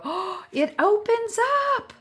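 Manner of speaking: imitating a thrilled recipient; delighted discovery. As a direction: act out the friend finding the flap. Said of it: "and then they'll go".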